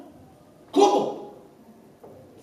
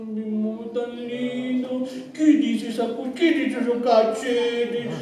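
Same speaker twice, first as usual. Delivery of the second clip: put-on baby talk to a son; slower than usual. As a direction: coo tenderly to the little boy. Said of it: "cómo le hablas". (padre hablándole a su bebé con voz tierna y cantada)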